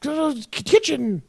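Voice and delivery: high mock Mrs Potts fuss; fussy teapot voice. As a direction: play the fussing teapot. Voicing Mrs Potts character.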